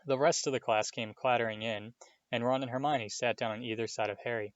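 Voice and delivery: usual speaking voice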